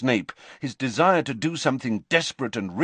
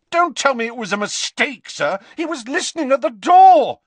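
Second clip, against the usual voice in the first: high, loud furious interruption; boy shouting over the headmaster; angry shout; 9 dB louder than usual. A narrator's reading. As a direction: cut him off with a yell.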